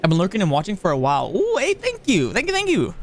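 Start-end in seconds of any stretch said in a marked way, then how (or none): 1.3-2.9 high, bright squeals of thanks; delighted thanks. Real, not acted. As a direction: gush your thanks to the viewer. Thanking a chat supporter.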